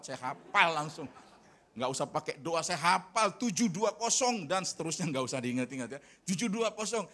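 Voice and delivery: loud, high eager rattling off of numbers; eager recital. Retold or imitated. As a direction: proudly rattle off the account number. Semangat sebut nomor rekening. (bersemangat menyebut nomor rekening)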